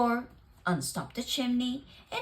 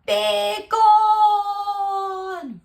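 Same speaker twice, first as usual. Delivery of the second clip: high, loud drawn-out roar; furious bear driving the mouse off; 11 semitones higher, 10 dB louder than usual. Acted. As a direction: bellow it with all the bear's fury. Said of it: "roared the bear".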